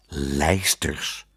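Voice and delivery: low, wondering echo; puzzled wonder. A narrator's reading. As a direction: repeat it in quiet, low amazement. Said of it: "zei meneer Pardoes verwonderd".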